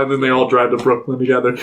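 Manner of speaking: ordinary talk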